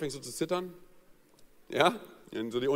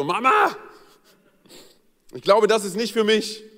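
put-on childlike plea; 5 semitones higher, 6 dB louder than usual. Child telling mother.

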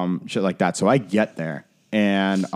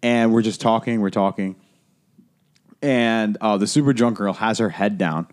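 ordinary talk

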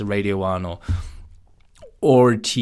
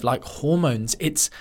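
ordinary talk, nothing marked

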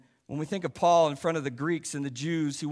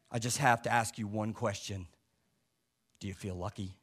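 low, quiet tough-guy growl, much softer; Clint Eastwood; 5 semitones lower, 5 dB quieter than usual. Acted. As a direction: play a cool, menacing Clint Eastwood. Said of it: "in his best Clint Eastwood voice".

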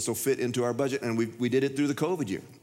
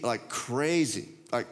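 ordinary talk, nothing marked

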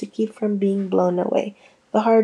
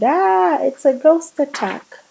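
scream; 6 semitones higher than usual. A narrator's reading. Nina screaming at the ghost attack, high and frightened. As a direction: shriek it as the room erupts. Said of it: "Nina screamed".

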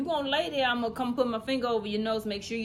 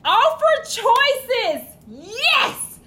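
loud, high, drawn-out cheer; elated high-pitched shout; gleeful announcement; 13 semitones higher, 12 dB louder, much slower than usual. Real, not acted. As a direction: stretch it out high and triumphant. Announces the next tip gleefully.

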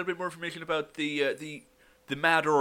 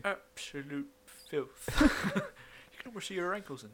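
prudish mutter; 4 semitones higher, 3 dB quieter, slower than usual. Acted. Character reading magazine.

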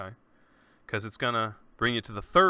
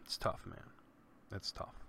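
breathy, near-silent grief; words barely get out through grief; real grief, almost no voice; choked whisper; 4 semitones lower, 15 dB quieter than usual. Real, not acted. Grieving his dead brother.

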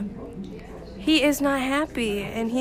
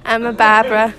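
barber said as Barbara, r-less; Boston accent; 10 dB louder, faster than usual. Shown as a demonstration. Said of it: "Can you say barber for me".

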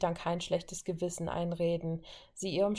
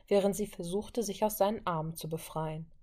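usual speaking voice

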